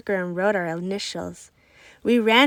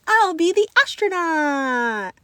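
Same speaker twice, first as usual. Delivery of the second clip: higher, louder and slow, thrilled; excited scream; 6 semitones higher, 5 dB louder, slower than usual. A narrator's reading. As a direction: claim the role with delight. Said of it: "I got excited and screamed".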